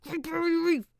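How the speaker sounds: high, tight outburst building to loud; mock angry. Shown as a demonstration. Showing how to say the term when angry, mock-furious. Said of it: "when you're angry".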